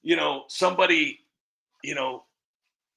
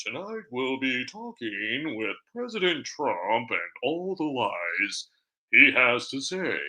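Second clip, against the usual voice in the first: low, softer, mock-proper anchor voice; very proper newscaster; 4 semitones lower, 3 dB quieter than usual. Shown as a demonstration. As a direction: sound like a very proper anchor, drawing out the ending. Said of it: "make themselves sound very proper".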